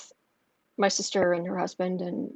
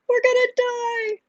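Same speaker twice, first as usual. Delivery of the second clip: much higher, slow, wailing imitation of a scared kid; mock child panic; 14 semitones higher than usual. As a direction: echo the child's panicked wail. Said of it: "yelling out".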